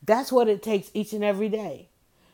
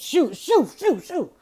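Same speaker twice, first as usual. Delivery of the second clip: shooing someone away; 6 semitones higher, slower than usual. Retold or imitated. Mimics being shooed out.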